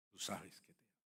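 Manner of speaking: Peter's soft confession of love; hushed tender reply. Acted. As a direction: say it barely above a hush. Peter's quiet answer.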